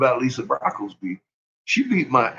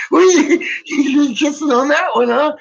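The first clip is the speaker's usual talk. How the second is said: loud, high teasing of the son; teasing shout; 11 semitones higher, 5 dB louder than usual. Retold or imitated. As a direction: rib the boy at full volume. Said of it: "teasing his son in the store".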